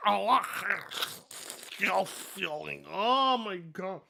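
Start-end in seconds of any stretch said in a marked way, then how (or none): 0.1-2.9 slow, warped mock of his speech; mocking Dreamcast guy. Streamer mocking the video creator's odd way of talking. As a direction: drag the words out the way he talks. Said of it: "doesn't even talk like this normally".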